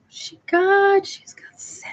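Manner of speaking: high gasp then hushed whisper; gasp, then gossip under your breath; mock whisper. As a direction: shoppers whispering in the aisle. Shoppers noticing her in aisles.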